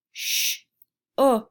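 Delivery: "Sh-o-k" split into separate sounds; sounding out letters. Shown as a demonstration. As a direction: sound out "Sh-o-k" slowly piece by piece. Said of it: "Say the word with me".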